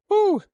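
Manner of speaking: short, very high, loud whoo as the set ends; relieved effort whoop. Real, not acted. Lifter finishing his set, high loud whoop of relief. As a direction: blow out a sharp whoop of relief.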